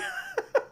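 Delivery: squeaky burst of laughter; high laugh. Real, not acted. Telling the funny story, high laugh. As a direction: cracks up at the memory.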